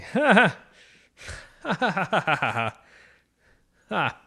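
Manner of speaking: loud high awkward forced laugh; nervous fake laughter. Caught by his wife in the messy bathroom, awkward forced laugh. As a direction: laugh it off sheepishly. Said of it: "I was like".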